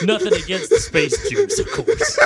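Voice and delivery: high, loud, laughing through it; can't hold back laughter. Real, not acted.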